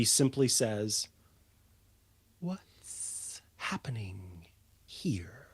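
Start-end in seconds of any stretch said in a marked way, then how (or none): 2.4-5.5 breathy hush, slow and low; soft whisper. Acted. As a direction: whisper it, nothing like a boom. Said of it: "expect her to have this booming voice".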